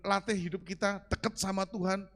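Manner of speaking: ordinary talk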